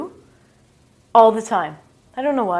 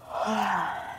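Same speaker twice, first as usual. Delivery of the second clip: demo yawn sigh; 9 dB quieter than usual. Shown as a demonstration. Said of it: "This is difficult to pronounce".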